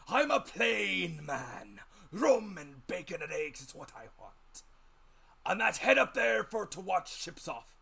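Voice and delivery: high, loud, slow rough sailor's voice; old sea captain. A narrator's reading. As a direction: growl it out like a grizzled old seaman. Captain describes himself.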